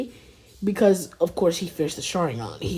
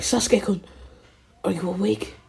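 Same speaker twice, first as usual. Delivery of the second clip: eager friend; 3 semitones higher than usual. Acted. Naruto checks on sleeping friend.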